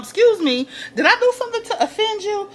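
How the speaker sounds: high, quick, prissy offense; mock offended. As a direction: play Liz feigning innocence. Liz confronting Mellie.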